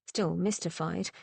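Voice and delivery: ordinary delivery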